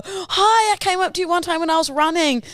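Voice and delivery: high-pitched, quick excited hello; gushing greeting. Retold or imitated. Runner rushing up to a dog owner, gushing. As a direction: greet a stranger far too eagerly.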